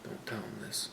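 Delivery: hushed secret whisper; whispering while hiding. Real, not acted. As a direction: whisper so he can't hear from outside. Brother outside, secret scoring.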